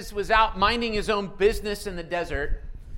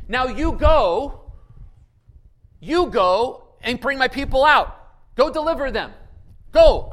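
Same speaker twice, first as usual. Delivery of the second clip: loud, raised, emphatic orders; commanding; 4 semitones higher, 8 dB louder than usual. Acted. God sends Moses to bring people out, loud and commanding. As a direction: play God issuing a forceful command, with weighty pauses.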